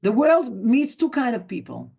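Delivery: ordinary talk, nothing marked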